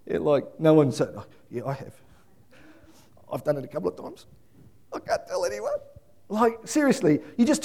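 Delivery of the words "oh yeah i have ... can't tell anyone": sheepish confession. Pretend. Imagined guilty parent confessing.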